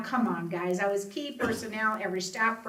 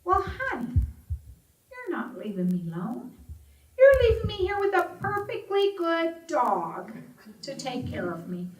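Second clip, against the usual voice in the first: put-on much higher, tender old mother voice; mama's voice; 9 semitones higher, 4 dB louder than usual. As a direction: play the sweet confused mother. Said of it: "and she said".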